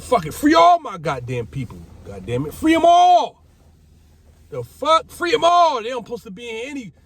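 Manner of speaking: high, loud yelling at the guards; retold shouting demand. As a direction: yell it like you're losing it, pitch up and loud.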